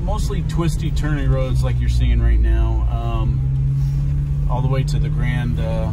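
shouting over road noise. Real, not acted. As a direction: project over the car noise. Talking in car over road noise.

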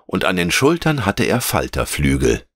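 ordinary delivery, nothing marked

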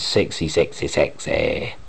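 hushed, low and drawn-out relish; lustful whisper. Real, not acted. Fan admiring Peri in her bikini, lustful hushed murmur. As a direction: drop to a slow, lecherous near-whisper.

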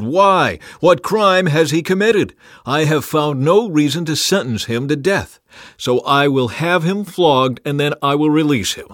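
loud, high insistent challenge; demanding. A narrator's reading. Pilate demanding a third time, loud protest. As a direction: argue forcefully with a crowd. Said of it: "he demanded".

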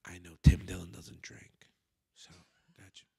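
secret whisper; hushed, sneaky aside. Retold or imitated. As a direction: lean in and whisper it conspiratorially. Bartender slipping him a secret drink.

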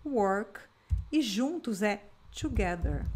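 ordinary talk, nothing marked

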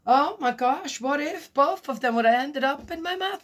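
picture the stings, aghast; alarmed realization. Real, not acted. Realizing the danger.

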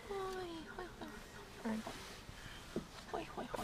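hushed coaxing calls; whispered hoi hoi; soft calling. Real, not acted. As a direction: softly coax the puppy out. Calling softly to puppy.

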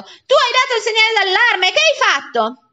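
loud, very high, outraged; acted furious scolding; shouted accusation. A narrator's reading. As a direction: yell at the culprit in disbelief. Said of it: "gridò il signor Clodoveo".

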